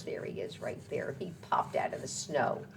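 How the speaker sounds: whisper. Real, not acted. Reading to a child, whispered aside. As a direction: breathe it out softly.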